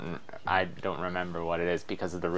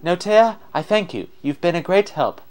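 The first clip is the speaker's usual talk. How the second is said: higher-pitched spirit giving thanks; pitched-up, courteous spirit; grateful wind spirit; 8 semitones higher, 4 dB louder than usual. Acted. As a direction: thank them in a high spirit voice.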